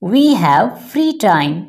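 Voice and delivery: ordinary talk, nothing marked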